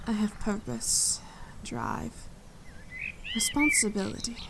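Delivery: soft proud whisper. Acted. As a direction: whisper each word with pride. Proud list of her duties.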